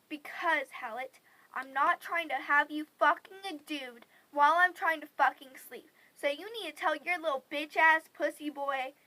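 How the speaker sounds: loud, forceful retold tirade; angry threat. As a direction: lay into your sister loudly, hitting the swear words.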